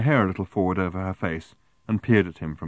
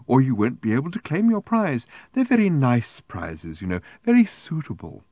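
primly insistent; 5 semitones higher, 4 dB louder than usual. A narrator's reading. Woman praising prizes to Arthur, primly insistent. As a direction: keep insisting in a prim high voice. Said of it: "insisted the woman".

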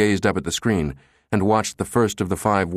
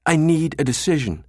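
urgent request; 8 semitones higher than usual. A narrator's reading. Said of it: "Jabba turned back to the director".

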